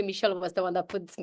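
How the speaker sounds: normal talking voice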